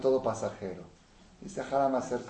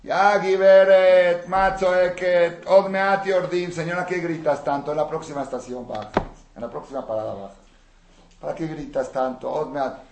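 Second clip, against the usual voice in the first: impatient passenger; 7 semitones higher, 10 dB louder than usual. Retold or imitated. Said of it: "alguien le dijo".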